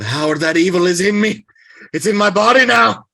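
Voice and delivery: rising panicked outcry; anguished cry. Acted. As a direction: cry out in terror of the evil inside. Possessed man cries out in agony.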